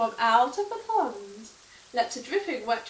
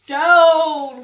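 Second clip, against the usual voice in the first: loud sharp command; cried out; 3 semitones higher, 13 dB louder than usual. Acted. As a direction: call the broom down hard. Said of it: "cried the witch".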